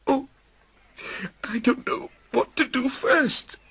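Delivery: put-on high, giddy overjoyed old man; bursting with relief; laughing and crying. Scrooge talks to himself on waking alive, giddy. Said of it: "laughing and crying at once".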